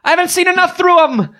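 Killarney insisting she must see the other side; yearning Killarney. Acted. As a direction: reach for freedom with every word.

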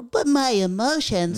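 high, drawn-out mock whine; mock crybaby. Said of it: "be a little bitch".

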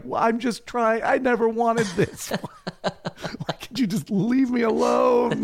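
high, pleading, laughing complaint; whiny Tiberius. Retold or imitated. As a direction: beg to be left alone, half laughing. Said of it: "he's truly just like".

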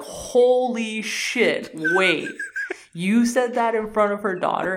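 high, loud mock astonishment; stunned disbelief. Retelling two characters learning of his blunder, acted disbelief. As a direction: draw out the shock, then question it loudly.

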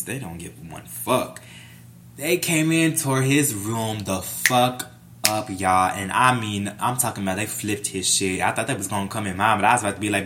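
usual speaking voice

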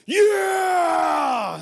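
loud, high, drawn-out cheer; long whoop of joy after scoring; retold elated shout. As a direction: imitate a kid shouting in triumph. Boy's elated cry after scoring.

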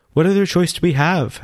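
a worried woman calls from the door; woman in doorway. A narrator's reading.

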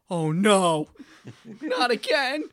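loud mock-horrified outcry; dismayed cry. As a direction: yell it in comic dread. Acts out own dismayed reaction.

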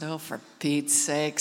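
deep, slow, fed-up mutter; exasperated groan. Retold or imitated. Preacher retorting to the prayer lady, deep and fed up. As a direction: sigh it out, thoroughly annoyed. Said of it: "I said".

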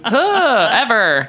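laughing. Real, not acted. Laugh bursting out.

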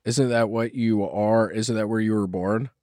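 ordinary delivery

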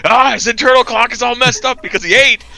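high-pitched exasperated rant; worked-up, high comic complaint; mock freak-out. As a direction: wail it in mock panic. Mock panic over son's schedule.

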